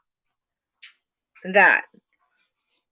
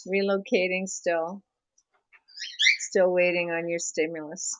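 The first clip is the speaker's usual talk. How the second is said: ordinary delivery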